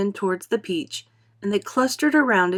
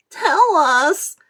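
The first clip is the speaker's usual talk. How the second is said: much higher, louder urging; eager cry; 9 semitones higher, 7 dB louder than usual. A narrator's reading. Earthworm crying to James for the plan. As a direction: demand it eagerly. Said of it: "cried the earthworm".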